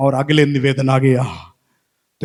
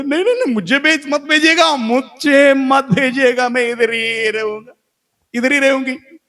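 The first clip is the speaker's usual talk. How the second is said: desperate pleading; 9 semitones higher than usual. Acted. Daughter begs Jesus not to send her back, high pleading.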